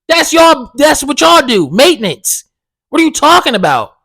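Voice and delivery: high, loud, heated shouting rant; retold angry rant. Tenant shouting at the maintenance man about the filter, loud angry rant. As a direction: tear into him over who pays, shouting.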